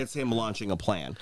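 ordinary talk

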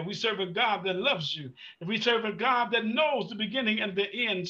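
usual speaking voice

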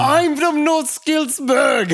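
very high shouted cartoon Scottish; Scottish impression. Willie delighted to meet a countryman, shouted Scottish. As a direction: holler it slowly in broad Scottish. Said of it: "that's a really Scottish phrase".

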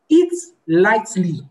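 slow, deliberate "lightly", drawn out; stress on lightly. Real, not acted. Teacher advising a hungry worker, slow emphasis on "lightly". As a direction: spell out "lightly" as the key word. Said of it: "Emphasis on the word lightly".

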